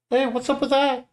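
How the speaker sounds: high-pitched Nami, hushed and curious; high girl voice. Acted. Nami asks her friend about a rustling noise, high girl voice.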